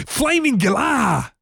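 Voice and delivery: high, drawn-out Aussie exclamation; slow, hammed-up broad Australian accent; Steve Irwin. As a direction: stretch it out in an extreme Aussie impression. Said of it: "We need a Steve Irwin voice".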